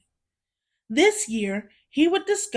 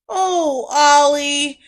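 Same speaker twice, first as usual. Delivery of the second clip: a touch of pity, louder; mild pity; 3 semitones higher, 6 dB louder, slower than usual. Acted. Sister sympathizing with Oliver, mild pity. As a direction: sigh over a little brother. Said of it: "his sister cried".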